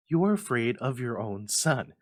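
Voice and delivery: loud accusing cry. Acted. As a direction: throw the accusation at him. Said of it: "she cried".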